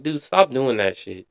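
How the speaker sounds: ordinary talk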